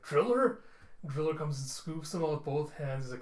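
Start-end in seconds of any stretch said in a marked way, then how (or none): 0.0-0.6 high, loud surprised call; startled at who just appeared; rescued man gasping out his rescuer's name. Acted. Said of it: "in surprise, like".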